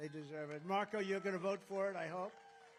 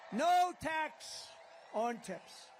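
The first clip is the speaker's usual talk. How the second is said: loud, high proclamation; rally slogan shout; 8 semitones higher, 6 dB louder, much slower than usual. Real, not acted. Candidate shouts his slogan to supporters, rousing shout. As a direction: belt the slogan out to a crowd.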